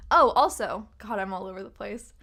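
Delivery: usual speaking voice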